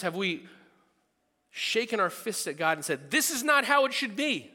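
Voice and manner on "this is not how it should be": indignant complaint. Retold or imitated. Shaking fists at God.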